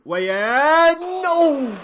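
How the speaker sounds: a fan's agonised scream at the screen; anguished shout. Real, not acted. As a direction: yell the name then cry out no in dismay. Near miss in the match.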